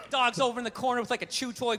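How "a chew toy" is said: normal talking voice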